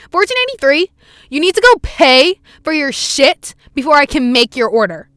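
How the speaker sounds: loud mock cashier rant; high, loud retold yelling; imitated scream. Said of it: "she screams and goes".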